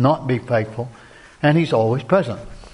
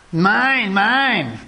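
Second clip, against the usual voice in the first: put-on childish mine; 6 semitones higher than usual. Thinking like a child.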